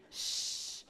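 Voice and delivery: shh, a long unvoiced hiss; sh sound. Shown as a demonstration. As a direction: hold the sound shh for the kids. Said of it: "she's gonna be".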